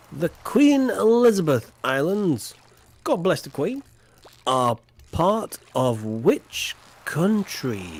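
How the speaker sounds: drawn-out slow reading of the question; a teasing, slow quiz question; slow quizmaster reread. Acted. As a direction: stretch the question out slowly for the players.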